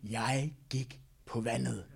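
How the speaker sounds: slow, low boast, word by word; playfully smug acted boast; bragging. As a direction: savour every word like a show-off. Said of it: "han kunne blære sig med".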